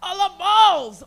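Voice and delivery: high, loud, drawn-out shout; hammed-up ecstatic yell; gleeful declaration. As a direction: proclaim it to the whole room. Mock proud declaration to crowd.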